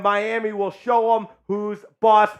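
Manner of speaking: normal talking voice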